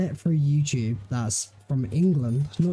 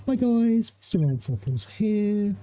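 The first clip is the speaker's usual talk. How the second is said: much higher, cheery YouTuber greeting; Milo impression; 7 semitones higher than usual. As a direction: chirp the greeting up high like Milo. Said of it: "you got Milo".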